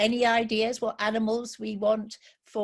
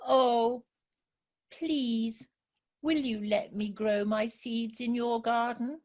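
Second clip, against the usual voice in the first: lightly raised, pleading little rabbit voice; 4 semitones higher, slower than usual. Acted. Little rabbit begging the field mouse, lightly pleading. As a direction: beg sweetly for a favour.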